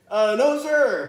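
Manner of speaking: high, loud military answer; recruit's shouted reply. Retold or imitated. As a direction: shout back to the drill instructor. Acted recruit answering drill instructor.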